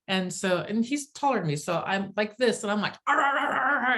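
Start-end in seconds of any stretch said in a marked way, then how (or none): 3.1-4.0 high, loud playful growls at him; mock growl at a tall husband; mock angry growling. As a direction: growl like a little angry dog. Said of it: "I'm just chewing on him".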